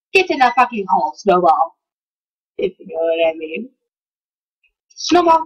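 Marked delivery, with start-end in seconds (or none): 1.2-3.7 low, knowing, suggestive drop; suggestive innuendo. Pretend. Telling the viewers, a low suggestive aside. 4.9-5.4 higher, called out to the pet; calling out. Real, not acted. Yelling for his pet Snowball, called out high.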